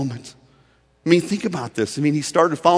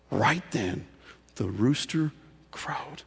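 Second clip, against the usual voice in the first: hushed, slow whisper; 9 dB quieter, slower than usual. Acted. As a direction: breathe the words out slowly. Dramatic climax of the story.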